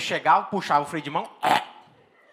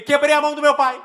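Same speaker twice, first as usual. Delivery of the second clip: panicked confession; 7 semitones higher, 6 dB louder than usual. Retold or imitated. Confessing to mom on phone.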